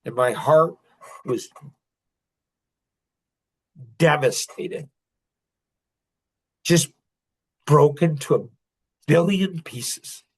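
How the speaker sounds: halting, choked-up recollection with long pauses; tearing up. Real, not acted. Father reliving his daughter's hurt, tearful and halting. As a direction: break it up with long, heavy silences. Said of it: "I just teared up".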